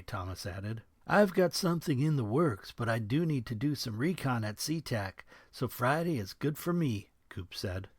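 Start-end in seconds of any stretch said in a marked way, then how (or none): 1.1-7.1 higher crude GI voice; a leering soldier. Acted. Coop voiced.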